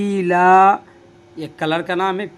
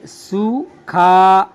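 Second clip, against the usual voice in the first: slow, drawn out; 4 dB louder, much slower than usual. Shown as a demonstration. Teacher showing students सूखा, slowly drawn out. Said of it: "ये वाव है".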